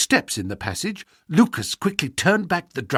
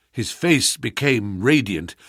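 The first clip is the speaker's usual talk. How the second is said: ordinary delivery, nothing marked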